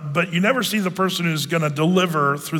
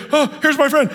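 mock urgent call; 8 semitones higher, 4 dB louder than usual. Bystander hails paramedics after accident.

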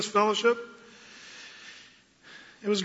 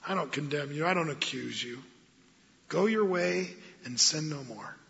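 soft, gentle and much quieter; gracious; 4 semitones lower, 8 dB quieter than usual. Retold or imitated. Pastor voicing Jesus forgiving the woman, softly gracious. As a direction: speak it low and tender, full of grace. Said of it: "the tone by which Jesus spoke, gracious".

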